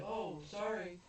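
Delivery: high, light mock sorry; mock apology. Onizuka's light, high mock apology under her. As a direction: toss off a cheeky apology. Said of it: "And he's like".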